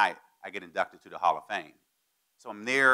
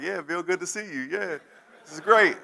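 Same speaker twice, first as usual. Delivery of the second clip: much higher, fake-happy voice breaking into a laugh; forced cheerful; 10 semitones higher, 5 dB louder than usual. Pretending to the crowd all is fine, fake cheerful. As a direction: put on a smile for the onlookers. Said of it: "trying to keep it together".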